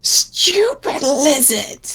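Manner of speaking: high, slow cackling hag; old hag. Acted. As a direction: sneer it like a wicked witch. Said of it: "the hag's voice says".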